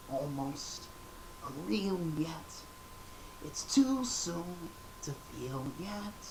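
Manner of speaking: soft, slow dreamy musing, fading to a whisper; wistful, faraway daydream; Gonzo. Pretend. Doing Gonzo's song, soft and slow. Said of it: "for that, I just kinda do".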